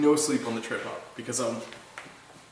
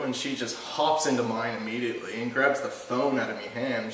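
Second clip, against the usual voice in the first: ordinary talk, nothing marked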